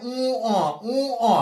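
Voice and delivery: উ, অ with a long nasal "uu" and separate "o"; letter-name demonstration. Shown as a demonstration. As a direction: stretch উ, then release অ on its own. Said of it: "অর্থাৎ এই যে রস্য উ".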